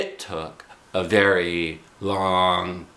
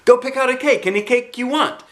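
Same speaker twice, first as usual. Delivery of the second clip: high, loud cheerful urging; happy urging; 5 dB louder than usual. A narrator's reading. Mother sending Junie B to pick a cake, cheerful. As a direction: send her off happily.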